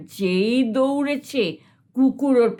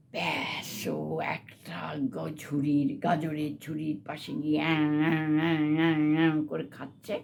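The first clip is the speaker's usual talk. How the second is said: softer, lower, munching sounds; soft munching narration; 6 semitones lower, 6 dB quieter than usual. A narrator's reading. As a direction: mimic greedy munching quietly. Hare munches carrots.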